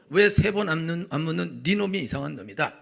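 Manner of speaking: loud, mock-angry retort; 상대를 탓하듯 크게 쏘아붙이기. Voiced quarrel, other side. (크게 쏘아붙이는 흉내)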